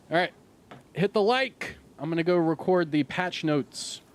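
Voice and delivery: high, loud streamer sign-off push; rallying call-out. Real, not acted. Calling out to viewers at sign-off.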